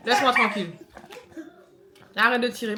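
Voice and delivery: loud, high laughing protest; laughing scolding. Real, not acted. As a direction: shout stop through laughter. Scolding child pulling mask.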